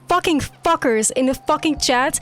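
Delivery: gloating rant. Real, not acted. Ranting at chat, loud.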